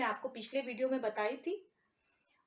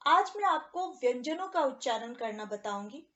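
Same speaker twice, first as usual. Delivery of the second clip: ordinary talk, nothing marked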